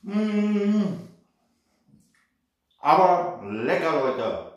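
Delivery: talking with a mouthful of hot food; eating while talking; mouth full. Real, not acted. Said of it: "Mit vollem Mund spricht man nicht". (mit vollem Mund sprechen)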